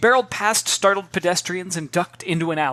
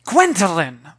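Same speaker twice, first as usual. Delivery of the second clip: horrified hiss; 2 semitones higher, 8 dB louder, slower than usual. Acted. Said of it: "Mother hissed in horror".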